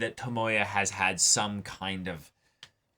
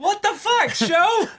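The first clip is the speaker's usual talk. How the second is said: high, loud laughing outburst at the show; cracking up in disbelief; 7 dB louder than usual. Real, not acted. Viewer laughing at the anime, high and loud.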